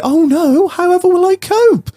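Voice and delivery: very high, theatrical damsel-style wail; sarcastic mock despair. Mocking the energy company's threat, fake despair. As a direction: feign helpless distress. Sarcastic distress at keeping own money.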